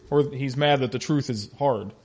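ordinary talk, nothing marked

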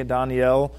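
Daniel said dahn-YEL, with an open ah; Daniel as two syllables, dahn and yel; Hebrew-style Daniel, open first vowel; Hebrew pronunciation. Shown as a demonstration. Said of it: "would be pronounced Daniel a little bit in the Hebrew".

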